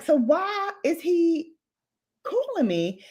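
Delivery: drawn-out puzzled question starting high; retold puzzlement, slow and high, then dropping. Storyteller wondering about the phone call, puzzled. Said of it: "So I was like".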